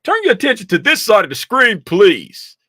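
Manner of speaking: high, loud call to attention; loud announcement. Real, not acted. Trader calls viewers to look at the screen, loud and high. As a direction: command the room's focus.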